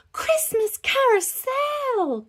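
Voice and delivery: high, loud, slow squeal of delight; drawn-out high-pitched shriek of joy; excited cry. Acted. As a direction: stretch it out like a thrilled child. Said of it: "cried Peppa".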